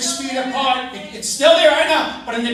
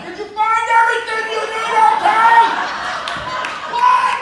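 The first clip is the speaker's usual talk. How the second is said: raised, higher voice calling through plexiglass; mock yell through glass; 7 semitones higher, 4 dB louder than usual. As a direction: shout it through a glass barrier. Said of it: "she's yelling to you from behind the plexiglass".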